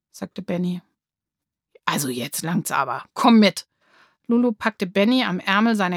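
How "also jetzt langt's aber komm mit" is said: losing patience, then a firm order; impatient. A narrator's reading. Lulu loses patience. (ungeduldig, zum Schluss lauter)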